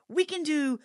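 high, eager run of ideas; excited retelling. Retold or imitated. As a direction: list each one with rising excitement. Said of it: "He was so excited".

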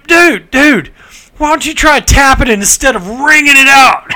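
punchline shout. Retold or imitated. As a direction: holler the advice across the urinals. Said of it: "He goes".

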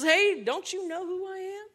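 high, menacing mock devil voice; the devil sneering at John; scowling devil. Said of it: "with the, with the scowl".

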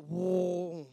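low, drawn-out "whoa"; imitated orchestra swell. Bridesmaids' music kicking up a notch, low orchestral imitation. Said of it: "with a little orchestra".

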